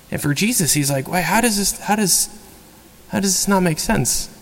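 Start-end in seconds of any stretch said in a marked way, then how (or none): 1.1-4.3 higher, bewildered questioning; as if the answer is obvious and you can't see why; baffled. Retold or imitated. Jesus baffled by disciples' confusion.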